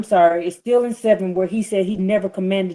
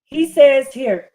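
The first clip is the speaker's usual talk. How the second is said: loud, slow, rising preacher's cadence, higher; preaching at full force; 6 semitones higher, 7 dB louder, slower than usual. Acted. Preacher reads God's words to arguing listeners, loud and slow. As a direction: thunder the scripture over the congregation.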